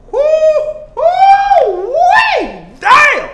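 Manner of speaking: high, very loud whoops and shouted damns; overwhelmed whooping. Acted. Man alone letting out his excitement over Faith, overwhelmed whooping.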